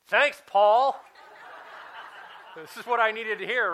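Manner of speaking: mock-grateful, loud and high with a laugh; sarcastic thanks. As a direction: grumble the thanks in jest. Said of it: "I go".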